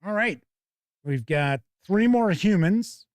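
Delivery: usual speaking voice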